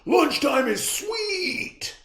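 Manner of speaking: yell. A narrator's reading. Clark at lunch, high and gleeful. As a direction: stretch it out with glee. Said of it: "yelled Clark the Shark".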